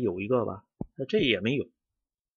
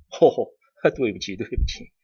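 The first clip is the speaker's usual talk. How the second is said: a laughing apology, louder; 7 dB louder, faster than usual. Real, not acted. Caught out by his blunder, he apologizes while laughing. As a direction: laugh it off while saying sorry.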